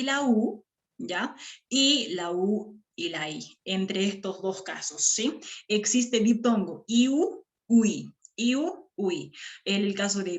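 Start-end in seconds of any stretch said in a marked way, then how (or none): none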